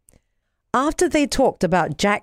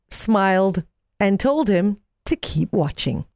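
ordinary delivery, nothing marked

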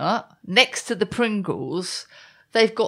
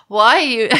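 mock American accent; 3 semitones higher, 9 dB louder than usual. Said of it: "Should I do that in an American accent?".

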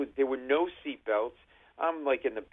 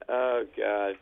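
usual speaking voice

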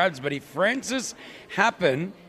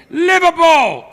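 very high, loud yell of effort; loud shout; 13 semitones higher, 13 dB louder, slower than usual. Real, not acted. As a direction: bellow it as you yank. Yanking hair on count three.

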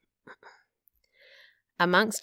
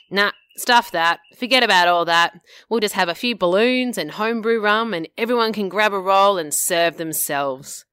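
gruff dismissive Matt; 5 semitones higher, 8 dB louder than usual. Acted. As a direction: wave her ideas away, loud. Matt dismisses her wedding ideas.

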